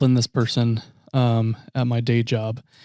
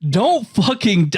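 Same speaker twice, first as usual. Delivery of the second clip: mock outrage; 6 semitones higher, slower than usual. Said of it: "And I'm like".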